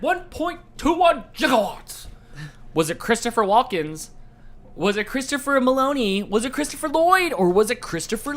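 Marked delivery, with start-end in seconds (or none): 0.0-2.1 quoting Doc Brown. Retold or imitated. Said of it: "This Chris said".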